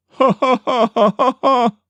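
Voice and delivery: grandson mimicking the high ha ha; imitating creature laugh. Frightened grandson imitating the Manitou's laugh, high cackle. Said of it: "was the way it sounded".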